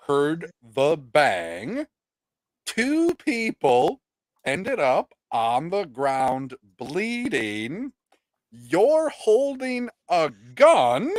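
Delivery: drawn-out words with swooping pitch; incredulous, stretched-out delivery; slow exasperated spelling-out. Real, not acted. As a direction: spell it out very slowly, as if to an idiot.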